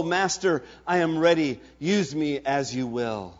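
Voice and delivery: normal talking voice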